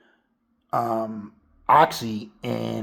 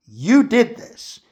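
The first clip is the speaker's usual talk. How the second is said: slow, high, louder accusation; accusing; 9 semitones higher, 5 dB louder, slower than usual. Retold or imitated. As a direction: drag it out and point the finger. Retelling Nikki blaming Bear.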